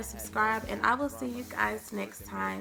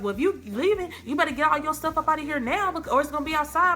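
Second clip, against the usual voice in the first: retold yelling; 5 semitones higher, 4 dB louder, faster than usual. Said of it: "He yelling or whatever".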